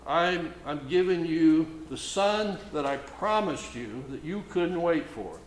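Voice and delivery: ordinary delivery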